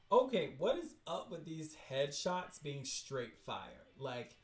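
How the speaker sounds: normal talking voice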